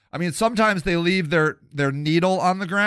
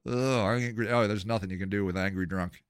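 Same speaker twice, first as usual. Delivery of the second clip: ordinary talk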